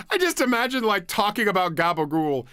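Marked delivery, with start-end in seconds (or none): none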